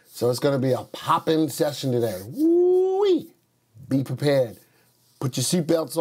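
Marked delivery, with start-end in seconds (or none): 2.2-3.3 excited whoop. Real, not acted. Hyped host whoops.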